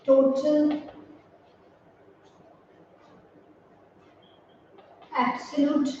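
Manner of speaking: each word alone with long pauses, slow; slow dictated list. Shown as a demonstration. Teacher dictating the word list to students, slow. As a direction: read the list out slowly for copying.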